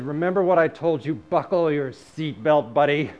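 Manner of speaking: loud, commanding voice from above; voice of God. Retold or imitated. God promising the writer a wild ride, loud commanding voice. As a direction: announce it boldly, like God to a buddy. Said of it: "the voice came back to me and said".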